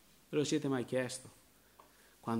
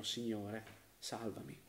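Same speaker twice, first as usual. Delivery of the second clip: hushed plea; 4 semitones lower, 14 dB quieter, slower than usual. Acted. Quiet cry for salvation.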